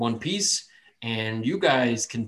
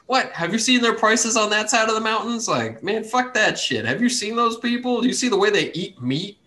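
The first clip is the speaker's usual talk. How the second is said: high, scornful mocking townsfolk rant; grumpy dwarf rival; 9 semitones higher, 4 dB louder than usual. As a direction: sneer at the other side.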